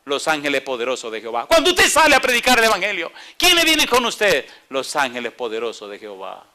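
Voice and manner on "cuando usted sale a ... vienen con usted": high, loud pulpit call to the congregation; preaching at full force. Acted. Preacher rallying the congregation, loud and high. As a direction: rouse the church with a ringing question.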